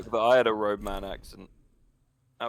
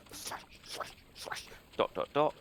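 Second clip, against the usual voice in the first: hushed, voiceless whisper; barely audible breath; whispered code; 11 dB quieter than usual. Shown as a demonstration. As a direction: whisper each word as a secret signal. Voicing quiet Morse code signals.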